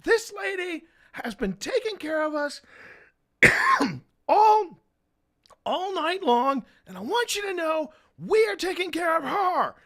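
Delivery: re-enacted high, strained announcement to a crowd; imitated cracking high-pitched proclamation. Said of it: "my voice is cracking".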